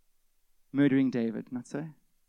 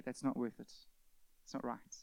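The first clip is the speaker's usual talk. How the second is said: quiet resolve; 4 semitones lower, 10 dB quieter than usual. Pretend. Voicing quiet repentance.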